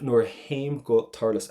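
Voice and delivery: slow "nor haim go tarlus", T softened away in "haim". Shown as a demonstration. Irish teacher demonstrates the poem's last part, softened T in "haim". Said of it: "H means shevu ... soften down that letter T".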